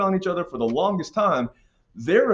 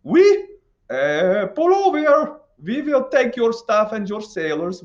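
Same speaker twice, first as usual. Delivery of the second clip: loud, high-pitched mock French; hammed-up French accent; 8 semitones higher, 6 dB louder, slower than usual. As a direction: play France bossing with a bad French accent. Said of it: "okay, that accent went off".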